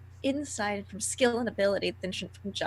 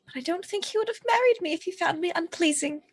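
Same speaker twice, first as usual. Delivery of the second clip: higher-pitched, mildly haughty comeback; in-character retort; 8 semitones higher, faster than usual. Acted. Bride answering the veiled woman about her husband, retorting. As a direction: answer back in a raised pitch.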